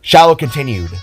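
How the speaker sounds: usual speaking voice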